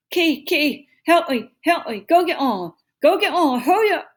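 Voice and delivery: high, loud garbled pleading; mouth stuck. A narrator's reading. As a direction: yell for help with lips stuck fast. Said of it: "like he had something in his mouth".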